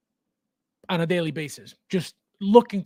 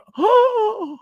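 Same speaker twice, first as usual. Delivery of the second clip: sharp gasp; 6 dB louder than usual. Acted. Reading a comment about broken figures.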